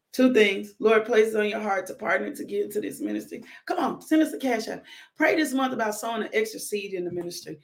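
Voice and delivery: ordinary talk, nothing marked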